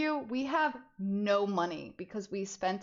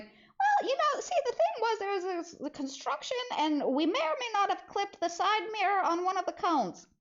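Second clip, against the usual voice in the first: squeaky, much higher, laughing on Well; nervous laughing confession; 10 semitones higher than usual. Retold or imitated. Admitting to mom the mirror got clipped, nervous laugh. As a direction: giggle to soften the blow.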